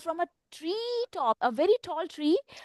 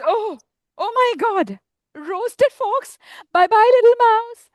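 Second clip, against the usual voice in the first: high-pitched mock alarm, getting louder on the goodbye; alarmed fox; 8 semitones higher, 4 dB louder than usual. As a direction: play a fox spooked into a hasty farewell. Fox alarmed at being roasted, flees.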